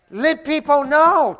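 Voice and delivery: loud, high, slow exhortation; full-force preaching. Acted. Pastor urging his congregation, slow and loud. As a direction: drive it home to the congregation.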